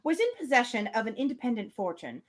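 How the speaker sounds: measured reading of a classic novel; story narration. Acted. Podcast host reading Emma aloud, measured narration. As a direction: read it like an audiobook narrator.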